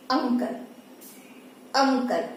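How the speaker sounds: अंकल opening on a short "uh"; isolated careful model of अंकल; correct pronunciation. Shown as a demonstration. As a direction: say अंकल as "UN-kul", no y glide. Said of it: "वो भी अ ही दे रहा है".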